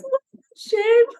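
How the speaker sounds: high, slow, hammed-up wail of pity; mock wail. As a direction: wail it like a tragedy, overdone. Acted quote of Ruby's reaction.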